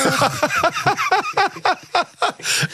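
high, long burst of laughter; laughing hard at the punchline; big real laugh. Real, not acted. Guest cracking up at the Giscard joke, real laugh.

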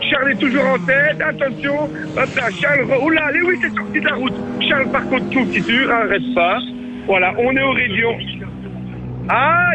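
commentateur sportif qui s'emballe, rythme vif; commentaire de course en direct. Acted. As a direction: fais vivre la course comme à la radio. (commentaire de course animé)

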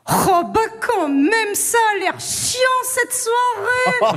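high, slow tipsy party-goer voice, dragging the words; a drunk guest, high and drawn out, bored by the party; Catherine Fro. Pretend. Comedian playing drunk Catherine at a party, high and drawling. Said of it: "Je me mets en mode Catherine Fro".

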